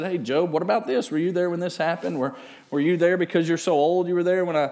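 rapid-fire questions, pitched high; challenging interrogation. Acted. Preacher voicing God grilling Job, challenging. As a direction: fire question after question, barely pausing.

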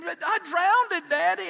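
slow, high, loud mock wail; crying little boy. Boy insisting to Daddy at the pool, imitated crying. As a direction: draw it out like a bawling child. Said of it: "He just kept crying".

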